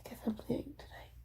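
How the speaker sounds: unvoiced whisper, holding back. Real, not acted. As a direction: whisper it so no one hears. Whispering venting alone.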